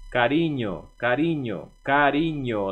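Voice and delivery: "carinho" with the NH sound as Spanish ñ; "carinho" repeated, nasal ñ clear; Spanish ñ in cariño. Shown as a demonstration. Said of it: "o N que tem o som de N H".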